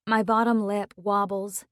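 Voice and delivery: plain reading voice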